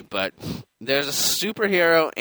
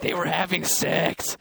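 slowed, higher deadpan doctor voice; doctor's blunt line; 5 semitones higher, slower than usual. Retold or imitated. Doctor blurting out the news, slow and raised. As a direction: drop it like a clueless doctor. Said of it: "and he's like".

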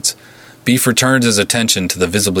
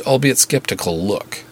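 ordinary delivery, nothing marked

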